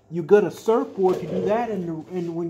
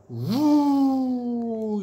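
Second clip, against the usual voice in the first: airy rising whoosh of a plane; woo; 5 semitones higher than usual. Pretend. Reading to a child, a plane swooping woo.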